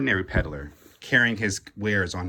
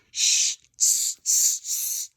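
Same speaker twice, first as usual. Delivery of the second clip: unvoiced tsk tsk; teasing monkey tsk; monkeys' tut-tut; slower than usual. A narrator's reading. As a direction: cluck back at him cheekily.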